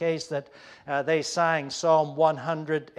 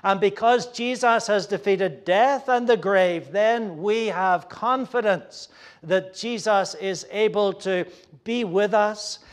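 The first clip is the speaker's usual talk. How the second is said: normal talking voice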